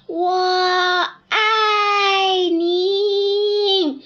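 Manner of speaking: very slow high echoing love call; canyon echo. Acted. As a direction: stretch it out like a mountain echo. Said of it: "的回声中".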